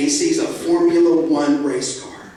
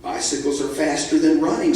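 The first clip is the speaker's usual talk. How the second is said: ordinary delivery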